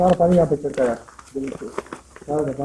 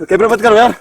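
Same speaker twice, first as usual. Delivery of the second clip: loud, higher urgent order; urgent shout; 5 semitones higher, 14 dB louder than usual. Real, not acted. Ordering the cameraman to stop, a loud urgent shout. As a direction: bark the order to stop filming.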